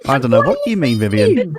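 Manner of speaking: mock innocent. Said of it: "mock denial after the impression".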